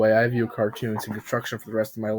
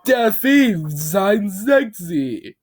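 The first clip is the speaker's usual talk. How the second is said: slow, very high, loud rant; unhinged scream; 8 semitones higher, 5 dB louder, much slower than usual. Acted. Bob rants like a lunatic, screaming slow and high. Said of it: "he screamed".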